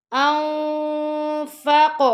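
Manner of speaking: انفق ending in a bouncing echo on ق; qalqala on qaf. Shown as a demonstration. Teacher showing qalqala at the end of انفق. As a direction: let the ق of انفق rebound. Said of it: "کاف پہ کلکلا کریں گے".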